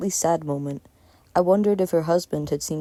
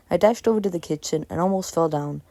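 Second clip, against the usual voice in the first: usual speaking voice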